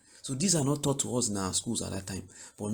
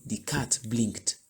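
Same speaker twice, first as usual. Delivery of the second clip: slow model sentence; slower than usual. Shown as a demonstration. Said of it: "blink and you say".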